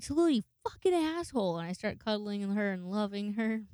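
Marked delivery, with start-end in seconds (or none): 0.0-1.6 loud, high-pitched indignant cursing; high mock-scolding yell; mock outraged yell. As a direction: curse out the runaway in hammed-up outrage. Retold drunk scolding of Tallulah.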